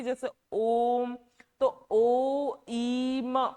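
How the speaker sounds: prolonged chant-like Om; drawn-out Om. Shown as a demonstration. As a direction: pull the sound out long. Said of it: "लंबा जब स्वर खींचते हैं".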